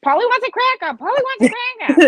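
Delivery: high squawking Polly wants a cracker; loud high parrot squawk. Retold or imitated. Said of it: "repeating over and over".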